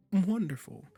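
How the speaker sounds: high, loud burst of delight; delighted exclamation. Acted. Caster pleased the listener obeys, delighted exclamation.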